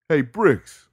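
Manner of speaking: loud high yell across cells; calling between cells. Acted. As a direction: shout it to the next cell. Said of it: "his neighbor yelled from the next cell".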